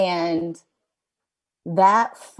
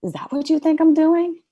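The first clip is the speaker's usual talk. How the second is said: much higher, louder gentle challenge; a voice in the spirit answers back; God answering; 10 semitones higher, 6 dB louder than usual. Retold or imitated. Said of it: "it was like".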